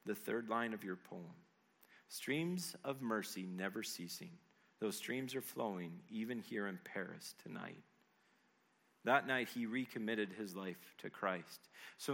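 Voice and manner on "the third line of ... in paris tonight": low, soft, tender reassurance; hushed narrated comfort; gentle. A narrator's reading. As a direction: console softly and without hurry. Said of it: "the woman said gently".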